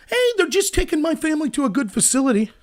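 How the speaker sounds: mock-placid and soft; mock calm, no yelling. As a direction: pretend there's nothing to shout about. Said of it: "no need to yell".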